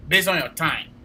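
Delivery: ordinary talk, nothing marked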